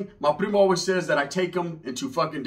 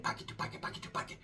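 breathy whispered "pocket to pocket"; hushed whisper, much softer; 17 dB quieter, faster than usual. Retold or imitated. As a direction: whisper it like a secret deal. Homeboy whispered phrase.

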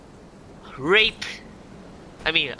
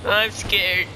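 normal talking voice

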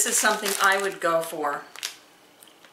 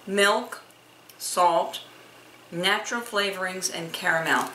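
normal talking voice

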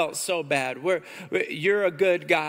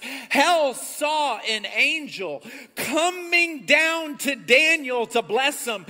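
preaching at full force; 6 semitones higher than usual. Acted. Pastor preaching to his church at full force. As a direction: preach it like a battle report.

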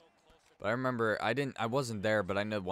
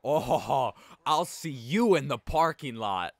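louder, higher threatening retort, slowed; threat; 5 semitones higher, 7 dB louder, slower than usual. Retold or imitated. Kid threatening the tall guy after an insult, louder and menacing. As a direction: spit out a parking-lot challenge. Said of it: "he was just like".

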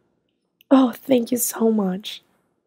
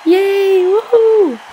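high, loud squeals of joy; excited kid cheer; 7 semitones higher, 5 dB louder, much slower than usual. Acted. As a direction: cheer like a thrilled child. Kids cheer again.

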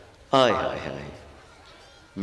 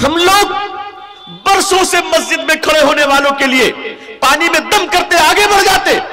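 loud, high sermon voice; impassioned preacher; fiery preaching; 7 dB louder than usual. Real, not acted. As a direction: rebuke the congregation at full force. Preaching at full force.

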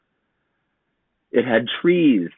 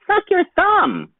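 put-on very high, slow tiny girl; squeaky little sister advice; 5 dB louder, slower than usual. A narrator's reading. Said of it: "said his little sister".

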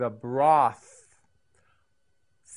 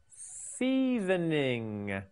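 very slow, softer, stretched-out seasoning; drawn-out model word; 5 dB quieter, much slower than usual. Shown as a demonstration. Teacher models seasoning for learners to repeat, slowly and softly stretched. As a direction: draw out each syllable for learners. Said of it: "You say it".

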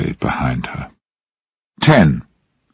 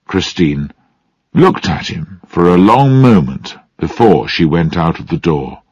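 ordinary delivery

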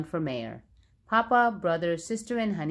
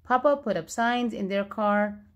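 ordinary delivery, nothing marked

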